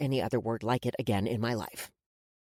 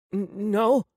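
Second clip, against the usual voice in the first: higher-pitched, halting answer; timid stammer; 5 semitones higher, 4 dB louder than usual. A narrator's reading. Boy near tears answers his captor, timid stammer. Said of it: "His bottom lip quivered".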